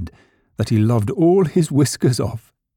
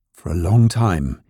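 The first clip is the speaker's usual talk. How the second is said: plain reading voice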